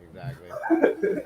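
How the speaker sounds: word dissolving into a high laugh; laughing too hard to finish. Real, not acted. Cracking up mid-word.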